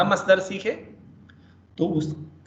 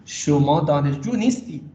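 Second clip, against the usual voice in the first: نیستید pressed, falling; stress on نیستید; 3 dB louder than usual. Shown as a demonstration. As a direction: hit the word نیستید. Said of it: "نیستید پہ آپ stress کریں گے".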